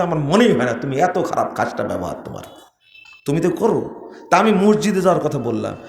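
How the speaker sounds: usual speaking voice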